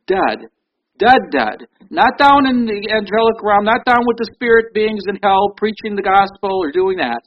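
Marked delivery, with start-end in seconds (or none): 0.1-1.7 "Dead" repeated, each one louder and falling; hammered repetition. Real, not acted. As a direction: drive home "Dead" with each beat.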